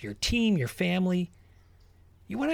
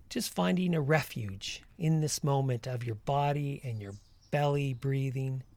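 guided meditation; 4 dB quieter than usual. Acted. Leads a walking meditation.

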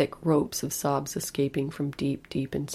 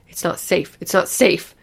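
put-on loud child's warning, building; urgent calling out; 2 semitones higher, 9 dB louder than usual. A narrator's reading. Lulu fighting for a hold on mother's arm, urgent calling. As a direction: call it out louder the second time. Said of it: "she called".

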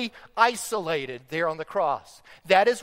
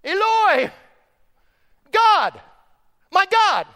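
loud, high isolated cries; preacher re-enacting the cry from the cross; crying out to God; 10 semitones higher, 8 dB louder, slower than usual. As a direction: call out each word with force. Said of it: "what does he say?".

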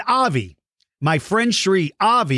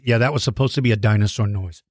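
usual speaking voice

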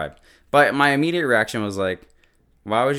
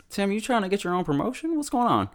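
high, quick mock interrogation; teasing suspicion; 11 semitones higher, faster than usual. Real, not acted. Viewer teasing Tim about his promotion, high and playful. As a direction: poke at him playfully.

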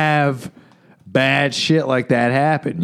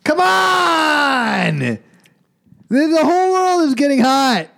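mock alarm; 10 semitones higher, slower than usual. Mock alarm about warming.

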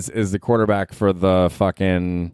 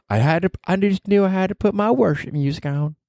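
higher, matter-of-fact mock Southern lady; matter-of-fact Southern wife; 9 semitones higher than usual. Host mocking the quarterback's wife, higher and matter-of-fact. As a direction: mimic her calm, matter-of-fact tone, pitched up. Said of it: "the matter-of-fact manner in which she said that".

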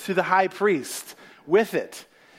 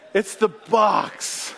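slow dramatic; 2 semitones higher, much slower than usual. Acted.

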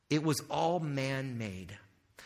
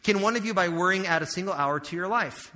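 loud, raised scripture reading; preaching scripture; 4 semitones higher, 7 dB louder than usual. Acted. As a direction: proclaim the verse to the crowd. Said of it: "This is how he says it".

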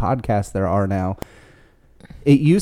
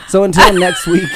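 laughing; 7 semitones higher, 6 dB louder than usual. Real, not acted. Restarting sign-off after laughing fit.

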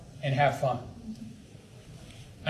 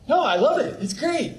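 higher mock cheerful denial; fake cheerful; 9 semitones higher than usual. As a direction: chirp it like an obviously false reassurance. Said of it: "Brady's like".

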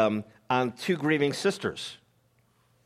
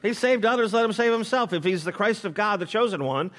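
scoffing rulers; 8 semitones higher than usual. Retold or imitated. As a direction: taunt him, daring him to prove it. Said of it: "also mocked him".

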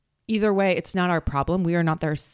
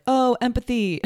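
high, bright sudden oh; mock lightbulb moment; sudden mock realisation; 6 semitones higher, 3 dB louder than usual. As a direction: snap into a cheery realisation. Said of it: "flip the script and be like".

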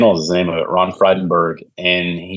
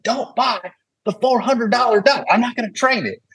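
pitched-up imitation of a country trainer; mimicking the good old boy trainer; 3 dB quieter than usual. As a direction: send up the old-school trainer warning him off. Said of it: "good old boy".

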